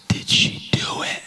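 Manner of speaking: whispered, suspenseful question; hushed whisper. Retold or imitated. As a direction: whisper it as if hardly daring to ask. Hushed question to his dad.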